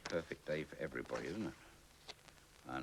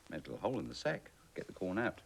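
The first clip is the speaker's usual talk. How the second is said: plain reading voice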